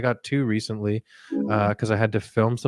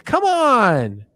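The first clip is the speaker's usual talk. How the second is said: high, loud indignant whine; mock outrage; 11 semitones higher, 4 dB louder, slower than usual. Victim whining at Pinhead, high and indignant. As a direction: whine at the villain in mock disbelief.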